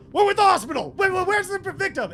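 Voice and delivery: loud, high burst of alarm; urgent shouting. Acted. Game master voices two people bursting in, urgent shouting. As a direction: shout it like rescuers charging in.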